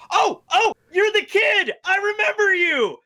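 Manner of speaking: high, loud burst of delight; excited recognition. Acted. William suddenly recognizes the kid, excited. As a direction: exclaim as if spotting a long-lost friend.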